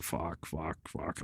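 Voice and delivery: low, very soft swearing; muttered dismay. Retold or imitated. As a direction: curse under your breath.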